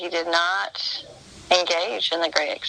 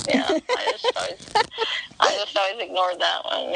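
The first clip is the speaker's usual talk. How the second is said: usual speaking voice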